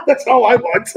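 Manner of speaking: words broken by laughter; laughing. Real, not acted. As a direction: laugh through the line. Laughing while saying it.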